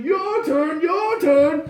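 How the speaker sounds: high, loud gleeful taunt; dragon king character. Acted. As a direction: goad the next player like a mad king. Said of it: "he's like".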